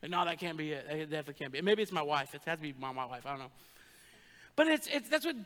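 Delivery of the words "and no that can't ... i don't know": muttered aside, much softer and lower; mock denial. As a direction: trail off into a sheepish aside. Joking self-denial aside, trailing off.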